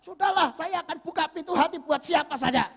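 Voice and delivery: high, exasperated mock resolve; resolute giving up. Single woman vowing to accept anyone, resolute. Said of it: "dia sudah bertekad".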